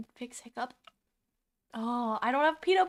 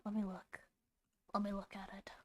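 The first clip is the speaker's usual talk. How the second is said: whispering; 4 semitones lower, 12 dB quieter, faster than usual. Real, not acted. Whispers to himself.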